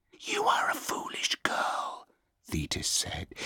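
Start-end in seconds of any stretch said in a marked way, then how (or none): 0.1-2.1 cold, unearthly whisper; eerie goddess whisper. Acted. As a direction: breathe it like a ghost goddess. Goddess scolds princess.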